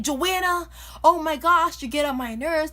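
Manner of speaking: loud, high mock scolding; retold angry screaming. Mad mom scolding her kid by the garbage can, mock screaming. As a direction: imitate an exasperated mom yelling. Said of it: "you're screaming at me".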